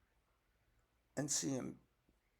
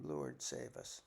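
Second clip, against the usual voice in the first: breathless whispered cry for help; hushed plea; 13 semitones lower, 6 dB quieter, slower than usual. Shown as a demonstration. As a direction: breathe it like a private prayer. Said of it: "you can always scream".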